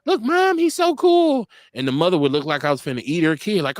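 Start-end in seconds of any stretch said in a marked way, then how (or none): 0.0-1.5 put-on high excited kid voice tugging at Mom; excited little kid. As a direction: play a starstruck child pointing him out. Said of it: "little kids coming to me like".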